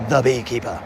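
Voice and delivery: low English movie-title voice; English accent. Acted.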